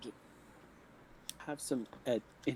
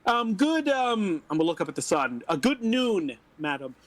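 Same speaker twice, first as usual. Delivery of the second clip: higher, louder formal greeting; lightly voiced polite adventurer; 4 semitones higher, 7 dB louder than usual. Player greeting the old woman, in a higher, louder formal voice. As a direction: put on courtly manners.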